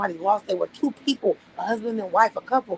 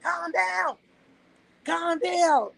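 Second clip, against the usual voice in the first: high, coaxing "calm down, calm down"; imitating a cop holding him back; mock police calming; 9 semitones higher, much slower than usual. Imitating the police restraining him, high and coaxing. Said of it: "police gonna be holding me the fuck back like".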